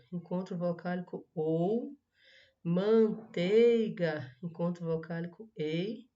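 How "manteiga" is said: "Manteiga" loudly stretched as man-tei-ga; very slowly split "Manteiga" into syllables. Shown as a demonstration. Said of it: "Separe em sílabas as palavras". ("Manteiga" bem devagar, separado em sílabas)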